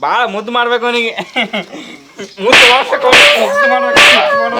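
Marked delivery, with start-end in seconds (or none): 2.4-3.2 ಮುದ್ದು ಮಾಡ್ಬೇಕು said much higher and louder, sneering; shrill mocking repeat. 3.4-4.6 much higher, louder sarcastic sing-song; shrill mocking repeat. Mother mocks spoiling the boy.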